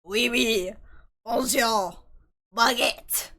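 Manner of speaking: put-on jokey French accent, word by word; mock French accent. Streamer voicing French Annette, mock French accent. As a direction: toss out French words in a hammed-up French accent. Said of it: "she has a French accent".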